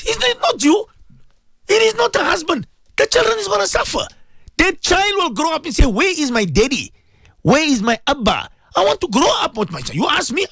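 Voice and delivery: high, strained pleading near tears. Real, not acted. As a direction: plead with the listeners, close to crying. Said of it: "I'm saying this in tears".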